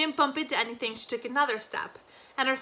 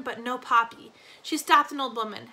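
ordinary delivery

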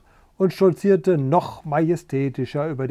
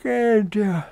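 yawning; 5 semitones higher than usual. Acted. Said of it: "fragte Theodor und gähnte".

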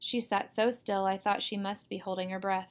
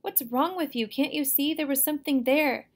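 higher, louder, exasperated questions; heated, worried outburst; urgent alarm; 5 semitones higher, 5 dB louder than usual. A narrator's reading. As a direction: plead with someone who won't see the danger. Brother urgently drags sister from danger.

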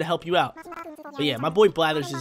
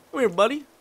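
higher, louder call with a rising end; 8 semitones higher, 6 dB louder than usual. Real, not acted. Player calling a game character over, louder and rising. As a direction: sing out to the little guy to come over.

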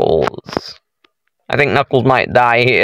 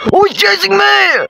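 very high panicked squeal; panicked shout. Real, not acted. Player chased by Sonic in the game, panicked shout. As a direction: shriek it while being chased.

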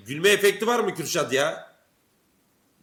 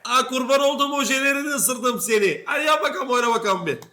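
mock gushing; 10 semitones higher, 6 dB louder than usual. Streamer fawning over a made-up Pikachu, mock gushing. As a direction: gush at full volume, stretching the words.

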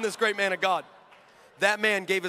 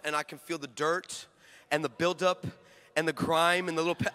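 ordinary delivery

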